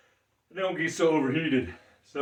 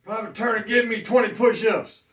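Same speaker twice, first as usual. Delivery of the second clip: higher, louder barked drill order; drill sergeant; 6 semitones higher, 3 dB louder than usual. Pretend. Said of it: "old school military style".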